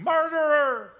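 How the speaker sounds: high, loud cry of accusation; shout. Acted. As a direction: yell it out across a crowd. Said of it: "Every time I open my mouth shouting".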